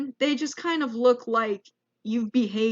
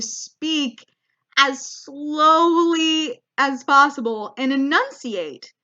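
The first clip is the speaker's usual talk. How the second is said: slowed right down, each word stretched and stressed; slow enunciating; 3 semitones higher, 3 dB louder, slower than usual. Shown as a demonstration. Describing dad talking to a clueless foreigner, slow and over-enunciated. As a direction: spell it out slowly and firmly.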